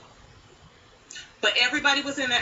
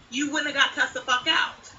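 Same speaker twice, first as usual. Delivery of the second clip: normal talking voice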